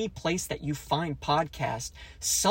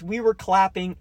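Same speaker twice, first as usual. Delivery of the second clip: ordinary talk, nothing marked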